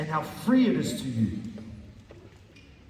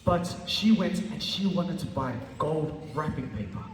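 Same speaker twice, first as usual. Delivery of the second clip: ordinary talk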